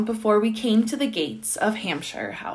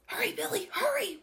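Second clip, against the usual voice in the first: high, hushed urgency; urgent whisper; 12 semitones higher, 10 dB quieter than usual. A narrator's reading. Pelican hurrying Billy as he picks cherries, urgent whisper. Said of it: "whispered the pelican".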